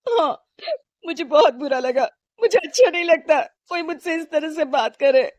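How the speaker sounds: speaking through tears; hurt wife admitting she felt bad; a touch of crying; tearful. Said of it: "जोर-जोर से रोने लगती है".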